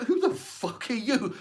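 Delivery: high, slow and laughing through it; mock-baffled, half laughing; incredulous outrage. Homeowner at the door, amused outrage at the stranger. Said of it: "It's like".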